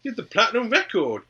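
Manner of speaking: high, urging silly voice; high mock voice. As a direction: urge it up high. Joking pleading.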